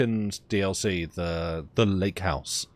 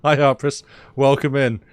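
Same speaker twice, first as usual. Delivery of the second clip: excited greeting; 7 semitones higher, 10 dB louder than usual. Real, not acted. Greeting a new viewer.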